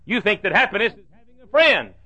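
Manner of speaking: rhythmic rising-and-falling sermon cadence; preacher contrasting happiness for the congregation; preaching. Acted. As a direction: set each pair up and knock it down. Preaching at full force.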